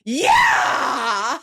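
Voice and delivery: hammed-up screaming cheer; imitated fan scream. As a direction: let out a drawn-out fan yell, half laughing.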